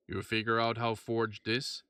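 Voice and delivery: slow, thick Quebecois drawl with a chuckle; a gruff Quebecois blacksmith, amused at the prize; thick Quebecois accent. Acted. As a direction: blacksmith grinning over a fortune, heavy Quebecois accent. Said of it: "he said in a thick Quebecois accent".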